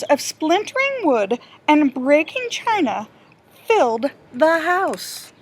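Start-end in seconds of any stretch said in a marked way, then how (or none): none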